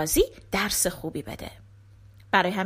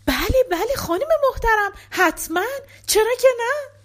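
much higher, louder eager acceptance; eager yes; 11 semitones higher, 4 dB louder than usual. Acted. Shepherd accepting the lady's proposal, high and eager. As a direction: blurt out yes in giddy disbelief.

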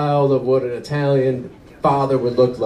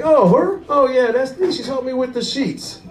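breezy guilty husband; 8 semitones higher than usual. Retold or imitated. Husband excuses himself.

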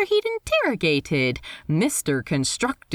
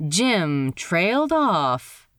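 ordinary delivery

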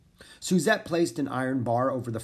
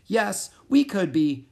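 eager; 7 semitones higher, 6 dB louder, slower than usual. A narrator's reading. Annie eagerly agreeing.

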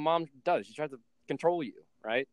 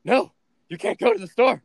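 frantic mom; 3 semitones higher, 6 dB louder, faster than usual. Retold or imitated. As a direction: imitate a worried mother rushing in to help. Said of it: "And my mom's like".

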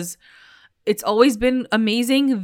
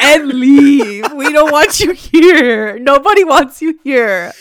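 louder, higher, slow shout; shouting him out; 6 semitones higher, 8 dB louder, slower than usual. Real, not acted. Fans yelling at Kevin to leave, loud shout. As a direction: yell at him to get out.

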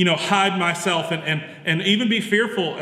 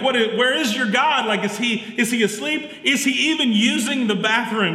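higher-pitched mocking questions, no real yell; mocking taunt; 6 semitones higher than usual. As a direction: taunt them like a mock wrestling promo. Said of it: "He actually yells out at them".

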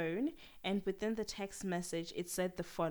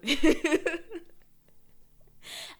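loud laugh; 9 semitones higher, 11 dB louder than usual. Real, not acted. Laughs at her own voice.

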